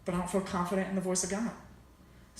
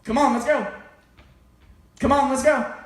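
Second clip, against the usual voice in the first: louder with each repeat, higher; coach yelling; 3 semitones higher, 7 dB louder than usual. Retold or imitated. Friend's dad yelling at runners from the middle of the park, building shout. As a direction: bellow encouragement across the park. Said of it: "and yell".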